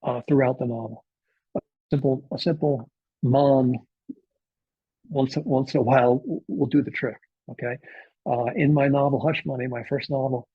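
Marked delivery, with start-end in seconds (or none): 1.6-6.8 West Indian accent. Shown as a demonstration. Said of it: "talking in West Indian patois".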